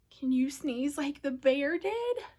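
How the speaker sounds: plain reading voice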